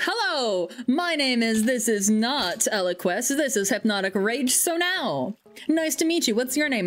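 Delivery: acted self-introduction. Introducing oneself to a stranger by the long name, hammed-up. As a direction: introduce yourself with exaggerated, lilting politeness. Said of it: "how do you introduce yourself?".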